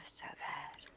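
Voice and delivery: soft whisper after a sigh. Real, not acted. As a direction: breathe it out, unvoiced. Sigh after mock plea.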